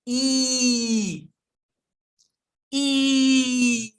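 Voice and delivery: long E, stretched and loud with a wide smile; big smiling E sound; exaggerated E. Shown as a demonstration. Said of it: "can we do a".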